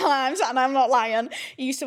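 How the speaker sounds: loud, high, laughing insistence; real laughter spilling over the words. Real, not acted. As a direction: burst out laughing and swear it's true. Laughs at own dad's antics.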